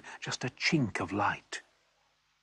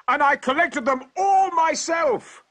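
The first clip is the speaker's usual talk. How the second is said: a big triumphant shout, much higher and louder; 7 dB louder than usual. Acted. Bloodied traveller shouting his boast to the terrified hunters. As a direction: yell it out boastfully, pockets full of diamonds. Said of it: "I shouted".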